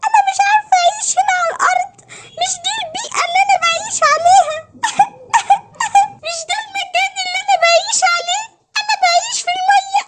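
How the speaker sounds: high, whining mock sobs; crying mermaid. Mermaid protests she cannot live on land, crying. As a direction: wail in a high little voice, breaking into pretend sobs. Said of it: "ما تعيطيش تاني".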